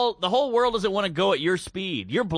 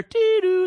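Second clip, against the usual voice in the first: high sung door chime dee-doo; 13 semitones higher, 5 dB louder than usual. Retold or imitated. Retelling the train arriving, a high mock door chime. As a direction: imitate the subway doors chiming, much higher.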